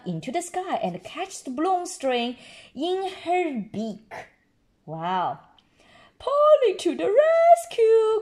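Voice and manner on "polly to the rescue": high, loud triumphant shout; excited cry. Acted. Grandpa Pig cheering Polly to the rescue. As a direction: cheer the parrot on. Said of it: "cries Grandpa Pig".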